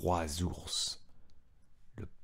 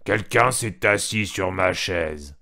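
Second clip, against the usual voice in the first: louder, slow papa bear cry; papa bear's big voice; 2 semitones lower, 5 dB louder, slower than usual. A narrator's reading. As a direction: drag it out like a grumpy papa bear. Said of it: "s'écria avec une grosse voix". (grosse voix de papa ours, lente et forte)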